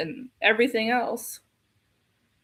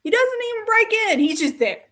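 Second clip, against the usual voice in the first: much higher and fast, in disbelief; rapid, high exasperation; incredulous rush; 9 semitones higher, 3 dB louder, faster than usual. Real, not acted. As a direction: blurt it out high and quick. Incredulous rant.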